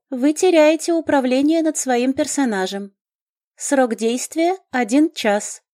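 high, formal game alert; system notification. A narrator's reading.

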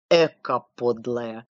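low sigh of disgust. A narrator's reading. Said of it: "вздохнул Дюдя".

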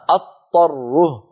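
أضطره said with a doubled ط and no ض; wrong example; doubled ta error. Shown as a demonstration. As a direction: demonstrate the mistake in أضطره. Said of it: "أكون قد نطقت طاء مشددة".

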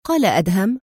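usual speaking voice

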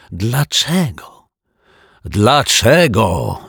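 slow, drawn-out angry question; angry why. Acted. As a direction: demand it, stretching each syllable. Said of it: "zapytał pan Mietek". (gniewnie, przeciągle)

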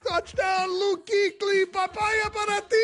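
very high, drawn-out touchdown call; mock Spanish sportscaster. Radio host echoing the Spanish clip, hammed-up high commentator call. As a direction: holler it like an over-the-top Spanish commentator.

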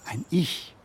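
ordinary talk

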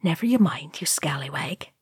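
hushed, dropping to a whisper; shushing Sam. A narrator's reading. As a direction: hush the youngster sharply under your breath. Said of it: "Sam shushed him".

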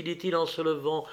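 ordinary delivery, nothing marked

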